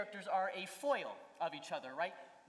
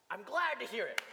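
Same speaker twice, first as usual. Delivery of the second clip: "glad" punched higher and louder; stress on glad; 4 semitones higher, 5 dB louder than usual. Shown as a demonstration. Director shows actor the operative word, stressing "glad". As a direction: hit the word "glad" hard. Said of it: "the operative word here is, uh, is glad".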